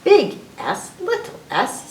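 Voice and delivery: usual speaking voice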